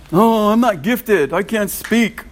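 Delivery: pleading. Retold or imitated. Preacher voicing Moses pleading with God, high and slow. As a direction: beg to be let off, slow and high. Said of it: "Moses pleaded".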